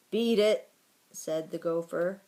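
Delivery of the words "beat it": loud, raised order; disagreeable dismissal. A narrator's reading. Gopher ordering the mouse out of its tunnel, disagreeable. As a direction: send the intruder packing.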